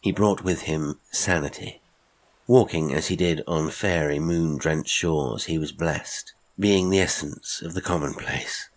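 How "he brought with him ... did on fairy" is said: ordinary delivery, nothing marked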